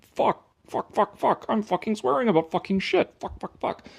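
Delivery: hammed-up mock swearing routine; mock George Carlin rant. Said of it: "George Carlin's shtick is".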